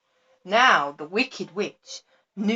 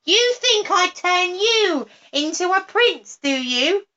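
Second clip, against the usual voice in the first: much higher, louder mocking witch voice; 11 semitones higher, 5 dB louder than usual. A narrator's reading. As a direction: taunt the toad in a witch's voice. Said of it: "she cried".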